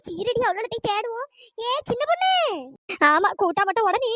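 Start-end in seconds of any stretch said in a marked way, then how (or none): 1.7-2.3 calling out. Acted. Searching the bungalow for the missing girl, calling out. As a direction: call into the house for someone lost.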